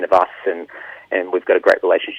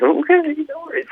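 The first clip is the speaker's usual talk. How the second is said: very high, resigned okay; squeaky giving in. Retold or imitated. Singer caving to the producer, squeaky and very high. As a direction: squeak out a defeated yes. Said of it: "I'm like".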